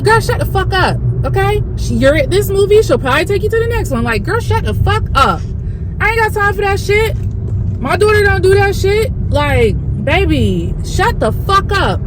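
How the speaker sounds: a hint of an irked mom scolding; irritated theatergoer silently telling a chatty teen to hush; mock irritation. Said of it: "I was irked".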